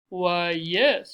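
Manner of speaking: loud, rounded, stately; reply as a grand refined matron; big well-bred voice; deep, cultured voice. A narrator's reading. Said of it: "her deep, cultured voice".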